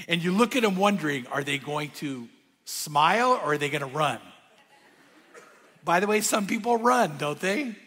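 ordinary talk, nothing marked

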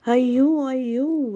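high, loud yelling; yelled. A narrator's reading. The dog howls at Job, yelling. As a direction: yell it out over and over. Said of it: "it yelled again and again".

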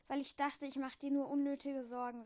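ordinary delivery, nothing marked

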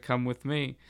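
ordinary talk, nothing marked

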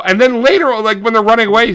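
normal talking voice

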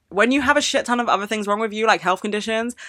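ordinary talk

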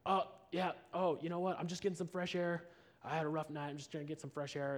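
caught-out, freaked-out babble, acted; imitating startled man. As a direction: play a jolted man covering himself. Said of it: "feels jolted and freaked out".

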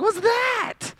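very high-pitched squeal of disbelief; hammed-up panic at a new ache; mock alarm. Preacher voicing someone turning thirty-five, mock-alarmed squeal.

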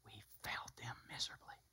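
soft unvoiced whisper, heavy with regret. Real, not acted. Preacher whispering regret to his congregation. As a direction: breathe it like a confession. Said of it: "whispered confession".

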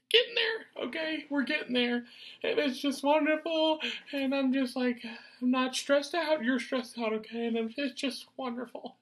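high, squeaky put-on voice, smug and carefree; mock cheerful falsetto. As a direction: gloat in a silly falsetto. Mocking the stressed-out listeners.

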